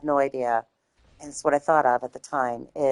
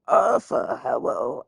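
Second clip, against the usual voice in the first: Tom Hardy impression; 2 semitones lower, slower than usual. Apologetic fan botching the impression, Tom Hardy voice. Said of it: "I'm not doing a good job right now".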